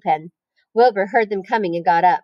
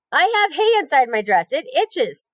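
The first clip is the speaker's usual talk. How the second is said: calling; 6 semitones higher than usual. Acted. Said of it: "called Fern".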